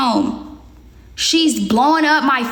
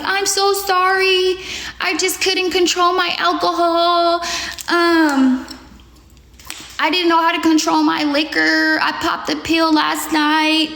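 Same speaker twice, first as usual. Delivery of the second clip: high, slow, mocking sorry voice; mock apology; 3 semitones higher, slower than usual. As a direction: drag out the fake remorse, pitched up. Reading friend's apology texts.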